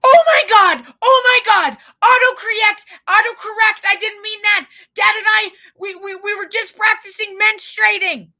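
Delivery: high, loud frantic mom, laughing through it; high, loud mock panic between laughs; panicked mom. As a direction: shriek it like a mortified mother. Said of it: "mom texts back".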